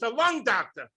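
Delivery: normal talking voice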